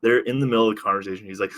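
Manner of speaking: usual speaking voice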